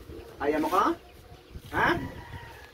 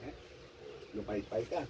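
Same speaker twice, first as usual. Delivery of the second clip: exorcism prayer; 6 semitones lower than usual. Real, not acted. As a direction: murmur the prayer over her. Healer recites exorcism prayer.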